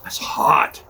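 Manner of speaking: a quick breathy whisper under the breath. Real, not acted. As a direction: whisper it to yourself, half to the camera. Reacting to the hot cigar.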